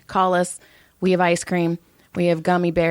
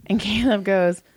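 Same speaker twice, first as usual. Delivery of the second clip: usual speaking voice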